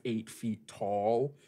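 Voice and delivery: ordinary talk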